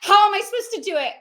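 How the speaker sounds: high, loud retold outburst; exasperated demand. Imitating friend who needs money for a house, exasperated. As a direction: protest at a high pitch, overwhelmed by the task.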